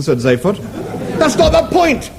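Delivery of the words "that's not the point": much higher indignant protest. Acted. Arthur protests to Zaphod, high and indignant. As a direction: insist in a high voice. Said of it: "Arthur protested".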